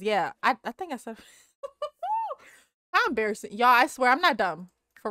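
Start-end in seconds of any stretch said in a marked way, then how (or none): 1.6-2.3 embarrassed laugh. Real, not acted.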